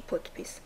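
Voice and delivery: پوتپیس softly, with a voiceless T. Shown as a demonstration. Teacher repeating the rule's example, پوتپیس softly with a T. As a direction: model پوتپیس as "potpis", softly.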